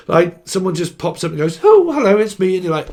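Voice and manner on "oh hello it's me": high, loud gushing hello; mock overfamiliar fan popping up; a stranger barges in all cheerful; chirpy stranger greeting. Someone pops up greeting him.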